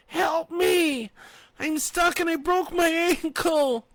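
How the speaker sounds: high, loud cry for help; desperate shout. A narrator's reading. Injured woman shouting to the sheriff for help. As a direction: shout it up the hole. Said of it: "she tried to shout".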